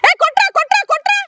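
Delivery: shouting encouragement. Real, not acted. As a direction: yell at the batter to hit. Cheering batter to hit.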